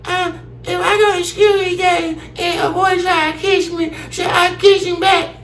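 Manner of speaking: put-on high, innocent child asking; little girl voice. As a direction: ask it like a small girl. Daughter asks about boy.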